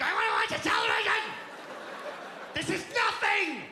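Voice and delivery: loud, high bratty yell; mock kid tantrum. Kid bored by nature screams for the television, bratty yell. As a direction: whine it out like a spoiled child. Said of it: "the kid's like".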